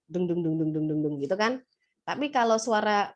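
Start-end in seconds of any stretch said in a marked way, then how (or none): 0.1-1.4 low booming dung dung of a thickened male voice; a teacher demonstrating a deep male voice to students; deep voice demo. Shown as a demonstration. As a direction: drop the pitch low to show a deep adult male voice. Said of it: "suaranya dalam gitu".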